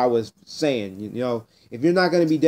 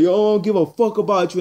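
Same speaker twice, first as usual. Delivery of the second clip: higher, mock-angry retort; retold angry girl; 5 semitones higher than usual. Girl in his face during the argument, higher mock anger. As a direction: throw her insult back, imitating her.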